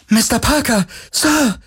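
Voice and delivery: very high, excited calling out; gleeful high-pitched hail; childlike joy. A narrator's reading. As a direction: call out with almost childish delight. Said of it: "rief ... mit fast kindlicher Freude".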